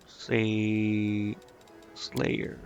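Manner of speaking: ordinary delivery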